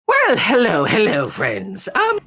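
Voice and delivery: a sing-song host welcoming friends; Mister Light voice. Acted.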